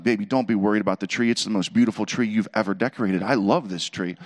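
smooth-talk the wife off the subject; husband sweet-talking to distract; soothing distraction. Retold or imitated. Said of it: "I put on my husband distraction techniques".

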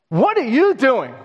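high, sharp challenge from a suspicious mother; indignant mother's what are you doing; startled demand. Retold or imitated. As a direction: catch the kids in the act. Mother catching kids with Bible.

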